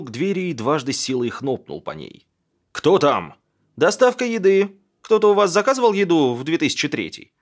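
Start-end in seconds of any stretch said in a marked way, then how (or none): 2.7-3.3 calling out. A narrator's reading. Woman calls through closed door. 3.8-7.3 higher, louder delivery call; calling through a door. A narrator's reading. Delivery man calls through door.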